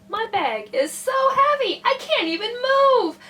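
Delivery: put-on high-pitched kid's plea; complaining child. A narrator's reading. Lance tells policeman about his bag, high complaint. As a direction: plead up high, like a little kid.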